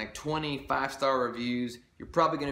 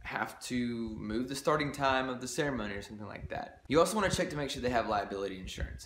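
ordinary talk, nothing marked

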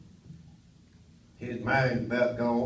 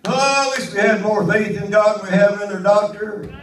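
higher, louder revival preaching; full-force preaching; 7 semitones higher, 9 dB louder than usual. Acted. Preacher rousing his congregation at full force. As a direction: lift the congregation, preach it hard.

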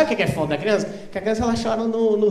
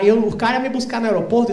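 ordinary talk

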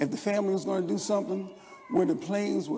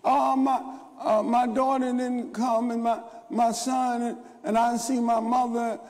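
higher, louder pretend grieving churchgoer; mock lament; 5 semitones higher, 4 dB louder than usual. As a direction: ham up the sorrow of the bereaved. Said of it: "So now we're hung up emotionally".

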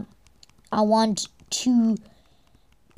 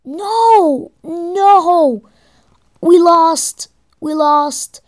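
loud, high wail of defeat; dismayed outcry; 4 semitones higher, 8 dB louder, much slower than usual. Real, not acted. Player cries out as the battle is lost, loud dismay. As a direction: cry out in loud, drawn-out dismay. Losing a game battle, crying out.

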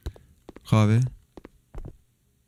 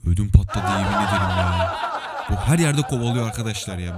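relieved laughing; 3 semitones lower than usual. Real, not acted. Relief after escaping the chase.

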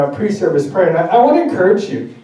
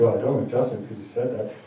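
sulky grudge; 8 semitones lower, 11 dB quieter than usual. Retold or imitated. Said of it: "if you carry offense afterwards".

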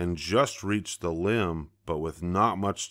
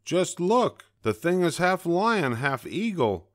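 high, startled jay outburst; blurted out; 10 semitones higher than usual. A narrator's reading. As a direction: blurt it out in alarm. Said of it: "a jay blurted out".

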